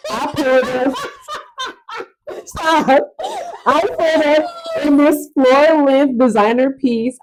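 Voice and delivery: high, laughter-broken reading; laughing while reading. Real, not acted. Co-host reading a listener's letter aloud, laughing through it. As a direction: crack up and try to keep reading.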